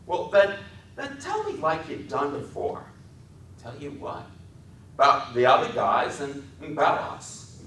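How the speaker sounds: put-on very high coaxing man-child; eager Lenny voice. A narrator's reading. Lenny asks for story.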